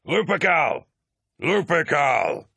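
high, loud chanted war cry; second cry slow and drawn out; battle cry. A narrator's reading. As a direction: roar the name back at the captain. Officers answer with battle cry.

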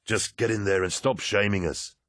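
tight, scolding hiss; impatient reproach; urgent hiss. A narrator's reading. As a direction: hiss it at a comrade. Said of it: "Aximand hissed".

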